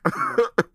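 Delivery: high, loud real laugh; a giggle fit. Real, not acted. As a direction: burst out laughing. Laughing at her own sensitivity.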